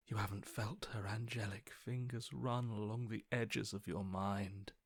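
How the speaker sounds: a tempting whisper; soft seductive whisper. Acted. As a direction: coax them under your breath.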